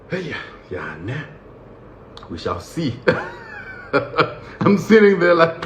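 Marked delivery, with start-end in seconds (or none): 0.1-2.9 low, soft mutter to himself; quiet, low wait-and-see tone; muted scepticism. Acted. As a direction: mutter doubtfully under your breath. Uneasy inner reaction to happy prisoners.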